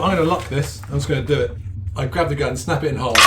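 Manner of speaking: usual speaking voice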